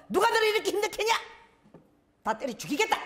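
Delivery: high, rapid-fire mock rage; hammed-up fury; acted angry outburst. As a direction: burst out threatening on someone's behalf. Acts out his sudden cursing outburst.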